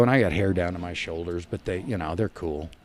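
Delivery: normal talking voice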